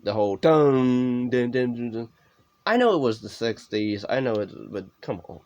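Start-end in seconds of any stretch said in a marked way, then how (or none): 0.4-2.1 drawn-out first dun, then quicker smaller duns; imitated music sting. Mocking the movie's music, an imitated dun-dun sting. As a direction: sound out a dramatic music sting. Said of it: "the music ruins it".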